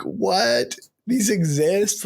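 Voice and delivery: can't believe your eyes; stunned disbelief. Retold or imitated. Hunter seeing the biggest deer of his life, stunned. First sight of a huge deer.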